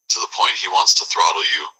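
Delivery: ordinary delivery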